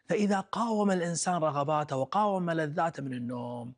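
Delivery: ordinary delivery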